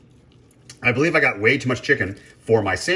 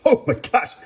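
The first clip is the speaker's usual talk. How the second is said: sudden high, louder oh my gosh; pained yelp; 13 semitones higher, 4 dB louder than usual. Real, not acted. Cook burns fingers on hot chicken, high startled yelp. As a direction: jolt as the heat hits.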